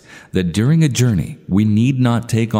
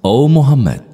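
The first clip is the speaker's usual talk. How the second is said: raised, higher calling voice; loud call; 5 semitones higher, 7 dB louder than usual. A narrator's reading. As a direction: call out loudly as if hailing someone. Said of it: "called out in a loud voice".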